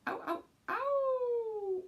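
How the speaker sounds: very high, slow drawn-out howl; wolf howl. Shown as a demonstration. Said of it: "It goes".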